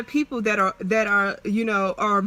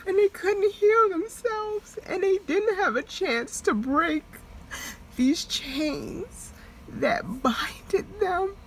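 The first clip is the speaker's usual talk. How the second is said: real crying; 7 semitones higher, 4 dB quieter than usual. Real, not acted. Breaks down talking about family.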